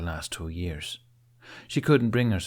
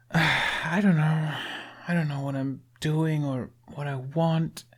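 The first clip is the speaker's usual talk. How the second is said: slow, higher, weary admission; lightly voiced frustrated Astrid; 7 semitones higher than usual. A narrator's reading. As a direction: drag the words out, a touch of a groan. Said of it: "she groaned".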